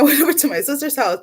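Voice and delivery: ordinary talk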